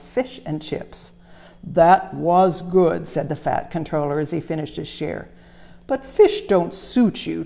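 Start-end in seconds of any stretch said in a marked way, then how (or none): 1.6-3.1 louder, booming boss; a big jolly boss scolding Thomas; fat controller voice. Acted. Controller after supper. 5.9-7.4 louder, booming boss; a big jolly boss scolding Thomas; fat controller voice. Acted.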